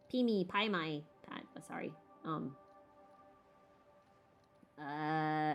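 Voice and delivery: ordinary talk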